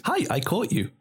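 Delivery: put-on high, sweet little girl; lightly voiced little girl. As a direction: chirp it like a proud eight-year-old. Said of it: "and she goes".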